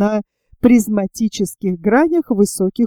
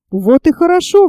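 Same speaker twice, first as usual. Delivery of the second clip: higher-pitched, mildly exclaimed approval; glad exclamation; 7 semitones higher than usual. A narrator's reading. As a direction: exclaim it with light relief. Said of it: "воскликнул моряк". (радостное восклицание)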